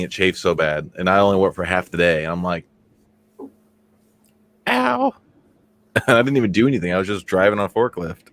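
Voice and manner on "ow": mock yelp of pain. Retelling the pain of chafing.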